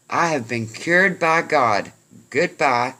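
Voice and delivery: loud, high declaration on the run; acted parting shout. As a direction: call it back emphatically while fleeing. Panicked runner shouts back farewell.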